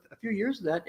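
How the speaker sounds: ordinary delivery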